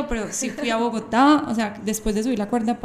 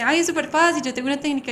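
higher and louder, cheerfully confident; breezy excited reassurance; 5 semitones higher, 5 dB louder than usual. Retold or imitated. Imitating new friend promising rope trick, higher and louder. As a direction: toss it off like it's nothing. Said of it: "Y ella me dice".

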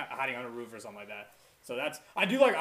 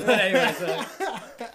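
laughing; 6 semitones higher, 9 dB louder, much slower than usual. Real, not acted. Laughing it off after joke.

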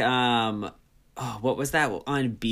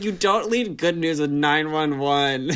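higher, louder laughing mock reproach; laughing scold; 5 semitones higher, 6 dB louder than usual. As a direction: tease your friend through laughter. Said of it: "I was like".

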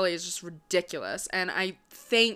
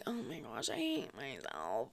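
a hint of a whiny self-hater, much softer; mock self-pity; 8 semitones lower, 14 dB quieter than usual. Vlogger imitating people who call self-hatred cute, mock softly. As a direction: mimic someone fishing for sympathy, softly. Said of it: "when people are like".

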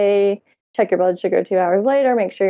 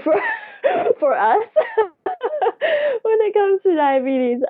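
words breaking into high laughter; laughing through words; 13 semitones higher than usual. Real, not acted. Telling the host her story, words dissolving into laughter. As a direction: laugh helplessly while telling it.

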